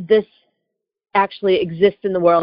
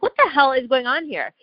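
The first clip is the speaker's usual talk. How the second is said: higher-pitched, exasperated demand; exasperated protest; 6 semitones higher than usual. Retold or imitated. Traveler watching agent search her leftovers, exasperated protest. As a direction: demand an explanation, voice raised in pitch.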